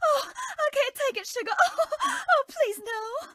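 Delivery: high, breathless pleading through laughter; laughing protest. Acted. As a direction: beg through giggles while tickled. Tickled by the player.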